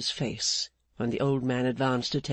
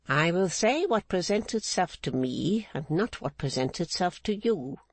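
Mrs Clennam; 4 semitones higher than usual. Acted. Mrs Clennam refusing Rigaud's demand, higher stern unbending woman. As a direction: hold the line like an unbending matriarch.

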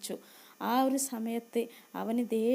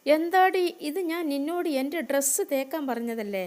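much louder, higher furious scold; angry scolding; 4 semitones higher, 6 dB louder than usual. Acted. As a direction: blast her for not ironing the dress. Sister scolds about unironed dress.

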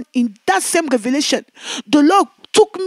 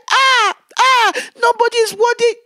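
high anguished cry; crying out in the vision; 7 semitones higher than usual. Retold or imitated. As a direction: wail that nobody is worthy. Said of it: "I was crying".

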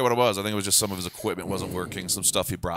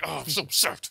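higher mock whiny upset; 8 semitones higher than usual. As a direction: make fun of an emotional driver. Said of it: "You're emotionally like".

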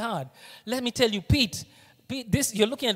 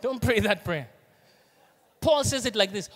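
usual speaking voice

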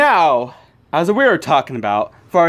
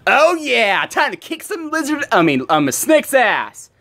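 cocky hero taunt; 8 semitones higher than usual. Acted. Deadpool taunting the villain.

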